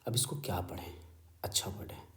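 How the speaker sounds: soft unvoiced whisper; thinking aloud in a whisper; whispered aside. Real, not acted. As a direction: murmur it under your breath. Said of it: "whispered thinking aloud".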